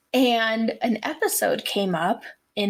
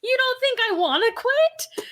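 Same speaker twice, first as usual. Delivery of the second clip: pitched way up, whining in disbelief; a much higher, put-upon Chandler; exasperated Chandler; 13 semitones higher than usual. Retold or imitated. Host retelling Friends to co-host, high exasperated Chandler. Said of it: "And he's like".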